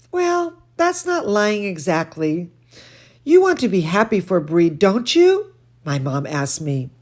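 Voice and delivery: lively read-aloud voice; storybook reading for children; story reading. A narrator's reading. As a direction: read to the kids with expression. Reads picture book aloud.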